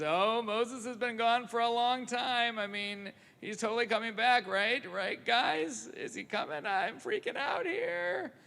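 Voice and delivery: hammed-up fear of a boy left behind; boy on a rock fretting that Moses is gone, mock panic. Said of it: "this boy panicking on a rock".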